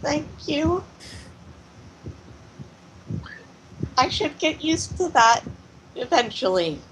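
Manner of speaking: high-pitched, halting little voice; high voice, hesitantly recovering after a scare; shaken character voice. Acted. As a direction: answer in a small high voice, with long pauses. Shaken Dora answers after tea.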